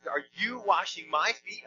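slow question with "you" and "my" both punched; stress on YOU and MY. Shown as a demonstration. Preacher demonstrating Peter's emphasized words, stress on "you" and "my". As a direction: hit "you", then lift "my" in disbelief. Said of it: "are definitely emphasized".

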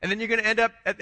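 ordinary delivery

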